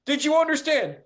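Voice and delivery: imitating badgering caller. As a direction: mimic a pushy nag who keeps repeating himself. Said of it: "badgering me".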